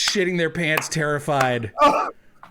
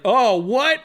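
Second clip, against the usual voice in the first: higher and louder, a startled gasp of disbelief; shocked oh what; 9 semitones higher, 5 dB louder than usual. Retold or imitated. Retelling his shock when the cop says he's taking him in, startled. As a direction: caught off guard by the fake arrest. Told he is under arrest.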